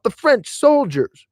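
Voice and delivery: high, loud, slow exclamation; excited cry. Retold or imitated. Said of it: "inclined to spring to my feet and cry out".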